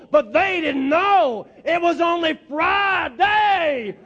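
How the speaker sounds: high, building to a slow shout on Sunday's coming; preaching at full force. Shown as a demonstration. Leading the congregation in call-and-response preaching, rising to a shout. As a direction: climb and roar the last line. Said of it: "Let's try it one more time here".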